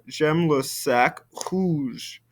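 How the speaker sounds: "J'aime le sac rouge" said slowly and clearly; slow careful French sentence; slow French model. Shown as a demonstration. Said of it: "Our noun comes first".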